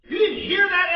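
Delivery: high, loud disbelieving question; loud rebuke. Acted. David challenging Eliab, loud and incredulous. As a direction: hammer the question at him.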